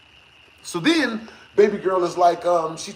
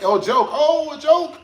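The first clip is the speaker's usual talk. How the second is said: high, louder mock of the excuse; 7 semitones higher, 3 dB louder than usual. Warning ladies, high mocking tone. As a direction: sneer the excuse back.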